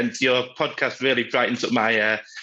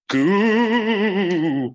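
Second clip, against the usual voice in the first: slow, drawn-out spooky answer; 7 semitones higher than usual. Pretend. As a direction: stretch it out like a haunted house host. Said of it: "I'm getting the hang of this spooky voice".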